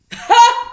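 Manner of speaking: high, loud short laugh; burst of laughter. Real, not acted. Vlogger laughing after recalling the journalist's name, high laugh. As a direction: let a laugh pop out.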